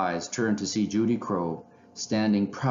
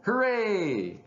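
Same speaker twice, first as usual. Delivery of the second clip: high, long-drawn hooray; excited cheer; 11 semitones higher than usual. A narrator's reading. Two friends celebrating their adventure, jubilant cheer. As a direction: sing out a gleeful hooray. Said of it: "they both shouted".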